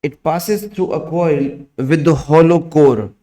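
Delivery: slow, word by word dictation; dictating. Acted. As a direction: dictate slowly for students to write. Dictation continues.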